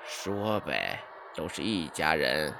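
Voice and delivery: lightly voiced uncle scolding the boy; old uncle, mildly annoyed. A narrator's reading. Uncle voice in story. (略带不耐烦的舅老爷)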